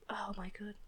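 ordinary talk, nothing marked

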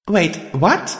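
high-pitched disbelief; startled high voice; stunned surprise. A narrator's reading. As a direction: blurt it, thrown by the news. Heroine stunned by the revelation.

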